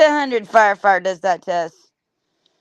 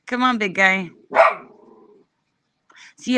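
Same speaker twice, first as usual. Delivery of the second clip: ordinary talk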